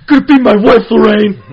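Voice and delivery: loud high bully voice; Biff impression. Quoting Back to the Future with a buddy, loud Biff voice. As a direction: shout it out like a movie bully. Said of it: "impersonating, uh, like, uh, Biff".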